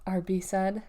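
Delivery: ordinary delivery, nothing marked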